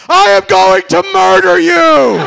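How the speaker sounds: murderous threat. Retold or imitated. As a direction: bellow it at the stranger. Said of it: "turned to the stranger and said".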